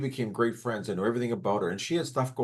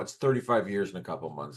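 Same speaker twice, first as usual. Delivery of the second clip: ordinary delivery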